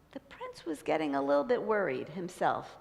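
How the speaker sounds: ordinary delivery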